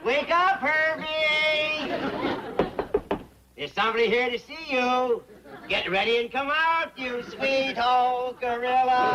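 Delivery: high singsong holler with held last notes; coaxing, drawn-out wake-up call; calling to wake someone. Acted. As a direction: sing out to a sleeper in another room. Calling to wake a supposed sleeper.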